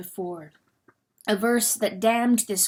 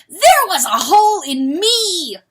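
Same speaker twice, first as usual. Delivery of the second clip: loud, high agonized cry; 9 semitones higher, 10 dB louder than usual. Acted. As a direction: cry out in pain. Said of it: "he cried".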